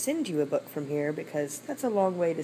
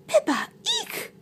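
very high tiny mouse squeak; squeaky mouse. Acted. Mouse coming to help frog, very high squeak.

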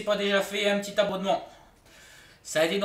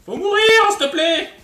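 high, loud begging; exasperated plea; 11 semitones higher, 11 dB louder than usual. Real, not acted. Player begging a tanky enemy to die, high and loud. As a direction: beg the boss to finally die.

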